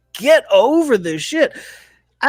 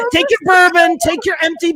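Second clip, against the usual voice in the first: higher, louder repeat of take your bourbon; 8 semitones higher, 3 dB louder than usual. Acted. Telling Scotty off, louder emphatic repeat. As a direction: punch it harder the second time.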